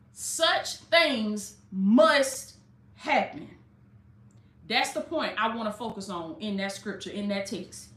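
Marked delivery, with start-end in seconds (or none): 0.1-3.6 slow and weighty. Acted. Preacher stressing the key scripture line, very slow. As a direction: draw out every word, very slow. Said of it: "Listen to this".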